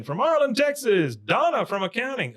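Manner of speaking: high-pitched hammed-up stage introduction; imitated show announcer. [name] introducing Bobby on stage, imitated hype announcer. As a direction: announce a performer to the crowd.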